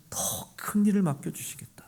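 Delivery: hushed, breathy whisper; whispered promise. Real, not acted. Preacher to congregation, a whispered promise. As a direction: let it drop to a whisper.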